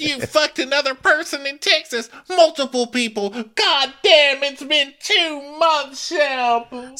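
high, loud mock outburst; hammed-up high yell at him; mock furious Taylor. Podcaster imitating Taylor yelling at Shep, hammed-up high yell. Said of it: "And she's like".

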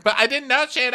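high, loud tearful imitation; hammed-up sobbing protest; mock crying. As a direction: wail it as a parody of crying. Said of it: "they're, they're, like, crying".

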